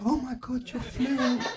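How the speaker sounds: high-pitched gushing friend; mock amazement. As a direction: imitate an awestruck friend in a high, small voice. Friends amazed at his restaurant Spanish.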